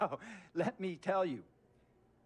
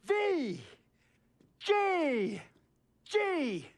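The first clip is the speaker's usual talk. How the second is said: much higher, spelled out letter by letter into a shout; shouted ultimatum; 10 semitones higher, 4 dB louder, much slower than usual. Acted. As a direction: yell each letter like a chant. Climax of the owner's demand.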